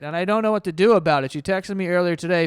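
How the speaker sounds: normal talking voice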